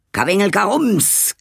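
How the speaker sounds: high little creature chatter; small creatures calling from the gutter, high. Acted. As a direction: squeak it out like a tiny thing in the drainpipe.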